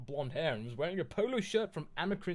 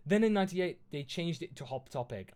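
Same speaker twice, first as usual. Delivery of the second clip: ordinary delivery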